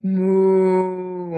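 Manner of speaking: long, loud, drawn-out moo; cow moo. Acted. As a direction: moo like a cow for little children. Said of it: "a cow goes".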